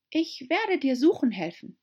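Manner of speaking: put-on high, eager young girl voice; pleading girl reminding the mouse; Alice's voice. A narrator's reading. Alice to the mouse, high eager girl.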